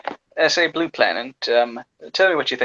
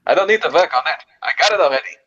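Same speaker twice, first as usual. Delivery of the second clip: put-on New York accent, pitched up. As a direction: answer back in a mock New York accent.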